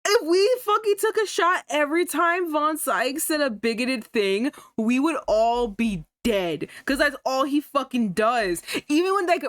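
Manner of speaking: worked-up rant. Real, not acted. Ranting at the racist character.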